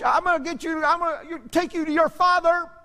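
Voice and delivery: high-pitched mock scolding lady; mocking a drill-sergeant lady ordering a child out; stern lady. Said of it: "she marched".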